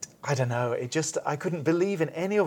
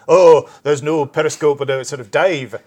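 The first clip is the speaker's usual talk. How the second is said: put-on a half-hearted Scottish accent, louder and a bit slow; mock Scottish accent; 10 dB louder than usual. Critic asked by colleague to do the accent, mock Scottish. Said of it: "you've gotta do the accent, [name]".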